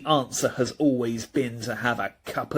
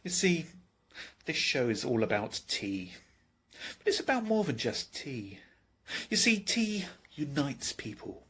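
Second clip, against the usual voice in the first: soft confiding musing; 8 dB quieter than usual. Acted. Sharing the philosophy of tea with the audience, soft and intimate. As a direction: draw them in quietly.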